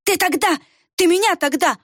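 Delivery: high, panicky stammer; frightened. Acted. Варх, cornered by the witch, panicky high stammer. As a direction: blurt it out in terror.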